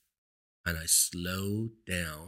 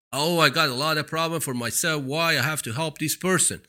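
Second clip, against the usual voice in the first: mock selfish whiner; 6 semitones higher, 6 dB louder than usual. Mocking selfish people's excuse.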